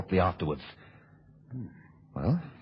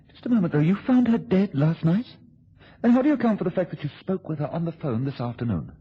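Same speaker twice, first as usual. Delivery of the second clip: sudden suspicion; 7 semitones higher than usual. Acted. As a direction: seize on the slip. Catches out the blackmailer.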